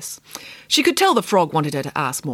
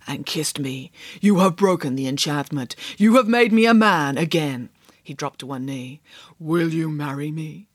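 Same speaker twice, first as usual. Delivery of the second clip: prince; 4 semitones lower than usual. Acted. Prince speaks and proposes.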